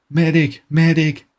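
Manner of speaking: shouting for a medic, high; man down shout. Acted. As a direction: call out loud for a medic. Calling for medic after wound.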